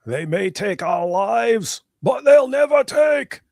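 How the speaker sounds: high, drawn-out heroic shout; hammed-up movie war cry imitation; Braveheart battle cry. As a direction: bellow it slowly like rallying an army, stretching the last word. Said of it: "worst Scottish accent since Mel Gibson in Braveheart".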